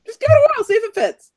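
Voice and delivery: excited. Real, not acted. Urging, teasing friend.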